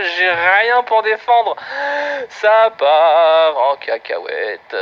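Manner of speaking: very slow, stretched comic dismay; slow hammed-up resignation; drawn-out despair. Real, not acted. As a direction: drag it out as everything falls apart. His game falls apart.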